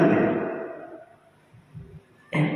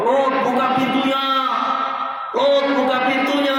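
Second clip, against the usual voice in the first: high jeering crowd voice, pitched up; mob yelling; 12 semitones higher than usual. Acted. Townsmen demand Lot open the door, high jeering call.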